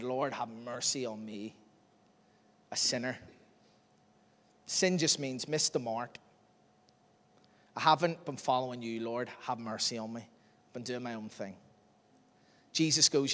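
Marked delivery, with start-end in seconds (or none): 0.0-11.5 humble plea. Retold or imitated. Sinner who won't look up, quietly pleading for mercy. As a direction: pray it small and contrite.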